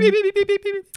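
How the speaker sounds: high, loud beeping noises; bomb timer beeps. Pretend. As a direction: beep like a ticking bomb timer. Beeps again at trigger phrase.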